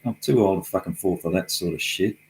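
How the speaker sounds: ordinary talk, nothing marked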